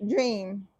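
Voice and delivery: ordinary talk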